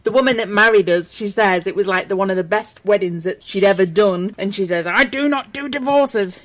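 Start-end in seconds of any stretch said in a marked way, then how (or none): 4.9-6.4 slow, firm, higher-pitched quote of a judge; stern warning. Retold or imitated. Judge warning newlyweds about divorce, stern. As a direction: lay it down sternly, word by word. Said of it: "she gave us a good stern warning".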